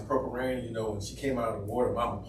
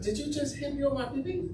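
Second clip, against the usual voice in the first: high mocking voice; 14 semitones higher than usual.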